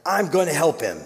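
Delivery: high mock yell over the crowd; father shouting to guards, a light yell; yelled back. A narrator's reading. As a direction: call it back over your shoulder. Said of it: "he yells back".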